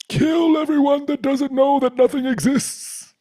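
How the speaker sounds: high, loud zealot's shout; fanatical fundamentalist. Acted. Mind turned Advaita fundamentalist, shouting high and loud. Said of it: "they're becoming an Advaita fundamentalist".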